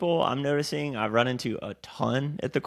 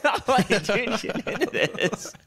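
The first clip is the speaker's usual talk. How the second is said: laughing; 11 semitones higher, slower than usual. Retold or imitated. Laughing retelling of instructor's hands-on coaching.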